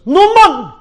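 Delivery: high, loud shout of the name; officer shouting the man's name. Acted. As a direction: call out to him. Officer calls Newman.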